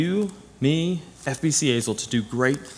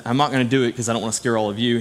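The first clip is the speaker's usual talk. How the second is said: ordinary delivery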